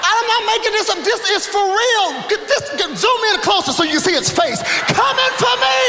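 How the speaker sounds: high-pitched, comic outrage; comedian's hammed-up alarm at a big cat; worked-up alarm. Real, not acted. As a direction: insist on the danger, pitch way up. Mock-alarmed showing of bobcat video.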